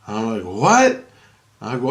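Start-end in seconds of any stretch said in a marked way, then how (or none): 0.4-1.1 high, loud "what?" of disbelief; acted startled disbelief; incredulous question. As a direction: shoot the pitch right up in astonishment. Retells reacting to the news.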